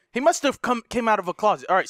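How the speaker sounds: normal talking voice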